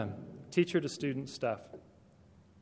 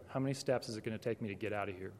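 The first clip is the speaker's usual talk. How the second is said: fast, quieter, anxious inner thought; nervous thought; 5 semitones lower, 6 dB quieter, much faster than usual. Retold or imitated. Man in office plans his escape from a crying mom, quick and low. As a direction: mutter it quickly as if eyeing the door. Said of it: "and I just go".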